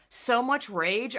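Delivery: ordinary talk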